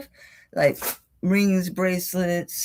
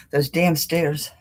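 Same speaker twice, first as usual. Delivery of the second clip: usual speaking voice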